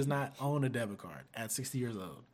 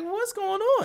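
surprised; 7 dB louder, slower than usual. Retold or imitated. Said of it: "it caught me off guard".

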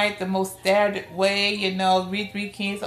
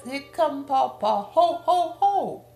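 father's Santa shout; 7 semitones higher, slower than usual. Retold or imitated. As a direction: boom it out like Santa arriving for the kids. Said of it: "he would shout".

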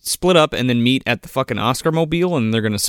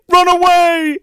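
loud, high panicked yell; shouting; 6 dB louder, slower than usual. Retold or imitated. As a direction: yell it as you flee. Characters flee shouting.

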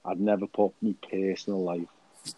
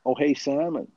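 hammed-up American tough talk, pitched up; American accent; 5 semitones higher than usual. Podcaster asking co-host what he's packing, mock American accent. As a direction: play at being American, badly. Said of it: "let's try and talk American".

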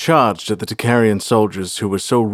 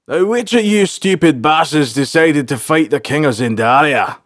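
raised, higher sergeant voice, scolding; exasperated soldiers' leader; 6 semitones higher, 4 dB louder than usual. A narrator's reading. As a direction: demand an answer from your stupid men. Group leader confronts his men.

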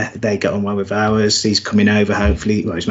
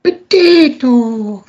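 drawn-out, much higher and louder, stretched slowly; mock Irish accent; 14 semitones higher, 7 dB louder, much slower than usual. Echoing his co-host's Irish joke with a slow, high mock Irish "Potato". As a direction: sing out the word like a silly stage Irishman. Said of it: "Potato on top of the morning".

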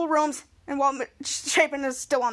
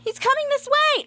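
much higher, eager pointing out; excited alert; 11 semitones higher than usual. Retold or imitated. Charlotte spotting the motorcycle, high excited call. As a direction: call it out as he comes into view. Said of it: "look at the corner and said".

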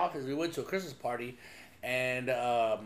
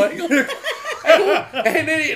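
laughing; 12 semitones higher, 12 dB louder than usual. Real, not acted. Laughing retelling.

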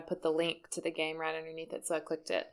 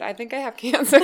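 mock dramatic; 3 semitones higher, 10 dB louder than usual. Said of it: "And you said".

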